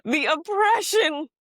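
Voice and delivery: high, drawn-out mock lament; mock outrage. Podcaster mocking drama over holiday greetings, mock outrage. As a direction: cry it out with exaggerated indignation.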